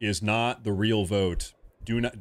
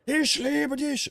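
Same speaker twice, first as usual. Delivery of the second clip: very high, stretched-out mock fury; mock angry German; much slower than usual. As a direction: yelp it like an enraged German wife. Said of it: "all about being angry in every "I love you".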